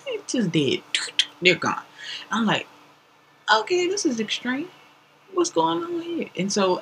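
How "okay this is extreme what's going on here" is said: alarmed. Retold or imitated. Alarmed retold thought.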